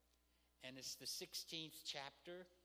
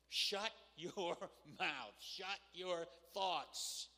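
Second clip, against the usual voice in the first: "Shut your mouth" word by word, slow and forceful; emphatic command; 2 semitones higher, 4 dB louder, much slower than usual. Shown as a demonstration. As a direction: punch each "Shut", stretch every word. Models a blunt command to oneself.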